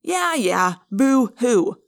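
mocking, dismissive sneer; fake-sympathy jeer. A narrator's reading. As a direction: scoff at their self-pity. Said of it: "Marco sneered".

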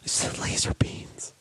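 whispered defeat. Acted. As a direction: mutter it under the breath in despair. Whispered despair.

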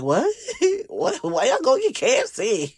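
high, incredulous mock question with a laugh; imitating puzzled listener. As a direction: play the baffled listener, pitch up. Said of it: "I know you're wondering, like".

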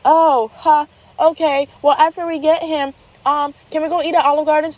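put-on high-pitched kid working an angle; imitated child's eager follow-up; bargaining child. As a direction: pipe up like a hopeful little girl.

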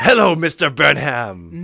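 slow, loud hammed-up hello; loud stagey greeting. Husband greeting Mister Burnham, loud and stagey. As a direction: draw out a booming acted greeting.

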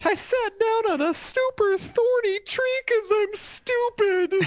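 high, drawn-out mock crying; tearful wail. Girl in tears by a thorny tree, mock wailing. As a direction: wail it slowly, hammed-up and tearful. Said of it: "she's, like, in tears".

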